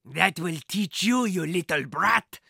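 slow, sneering villain voice; scowling. A narrator's reading. Thief sneering at the boy he just threw, slow and scowling. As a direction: spit it at the boy, slowly. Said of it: "he scowled".

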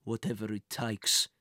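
villain's grim vow; cursing. Acted. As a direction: finish the threat darkly. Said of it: "he cursed".

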